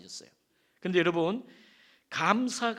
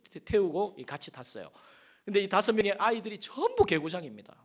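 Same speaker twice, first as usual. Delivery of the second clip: ordinary delivery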